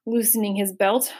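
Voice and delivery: ordinary delivery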